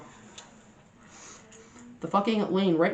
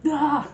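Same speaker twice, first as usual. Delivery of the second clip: crowd groan; 9 semitones higher than usual. Retold or imitated. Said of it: "from everyone in the store".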